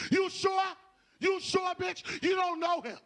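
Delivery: high, loud warning from a friend; high worried girlfriend. Acted. Friends warn her about him.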